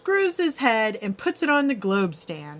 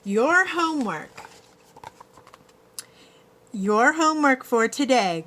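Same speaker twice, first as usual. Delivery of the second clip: grinning teacher; 4 semitones higher than usual. A narrator's reading. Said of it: "grins Missus Green".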